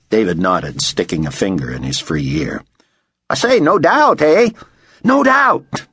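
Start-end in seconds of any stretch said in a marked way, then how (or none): 3.3-4.5 high, pushy Aussie repeat; insistent. A narrator's reading. Driver pressing distracted director to agree, insistent. 5.0-5.9 very high, exasperated shout. A narrator's reading. Director on the phone to chattering driver, shouted. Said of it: "David shouted".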